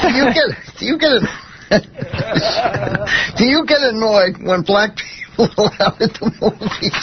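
laughing through the question; cracking up. Real, not acted. Examiner fails to keep composure for the test, cracking up. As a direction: try to ask it while giggles break in.

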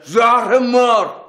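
slow, high furious bark; father yelling at his insolent son; angry shout. Acted. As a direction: bite off the curse in a rage. Father scolding rude son.

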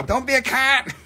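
joking Australian voice; mock Australian accent. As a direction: put on an Aussie accent. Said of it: "If I was Australian".

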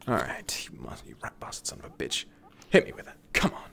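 soft whispered curse; whispering. Real, not acted. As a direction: breathe it under your breath. Muttering at the boss.

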